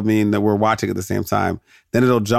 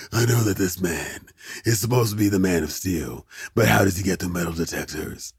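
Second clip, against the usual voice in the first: Batman; 2 semitones lower, 4 dB quieter than usual. Acted. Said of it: "That's what they sound like".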